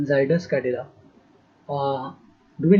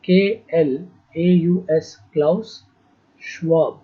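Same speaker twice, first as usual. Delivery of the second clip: letter by letter, long gaps; slow spelling dictation; slower than usual. Real, not acted. Teacher spelling a name for students, slow dictation. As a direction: spell it out very slowly for copying.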